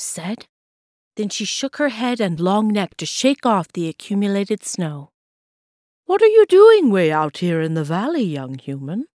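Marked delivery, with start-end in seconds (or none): 6.0-9.1 curious moose. A narrator's reading.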